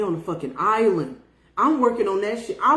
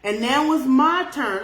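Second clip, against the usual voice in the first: ordinary delivery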